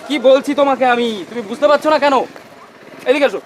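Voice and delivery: frustrated director, voice pushed up high; exasperated scolding. Acted. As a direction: berate a hopeless actor on set. Director scolds actor on set.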